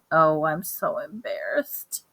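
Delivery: slow, hushed whisper of embarrassment; a mother mortified in a shoe store, whispering; embarrassed whisper. Acted. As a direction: whisper it so no one hears.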